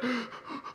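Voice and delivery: sharp tearful gasp in; retold gasp. Retelling telling his friend the news, a tearful gasp. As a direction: catch the breath before the sob. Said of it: "Had me all crying and weeping".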